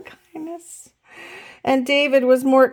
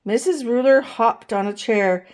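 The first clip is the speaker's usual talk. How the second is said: ordinary delivery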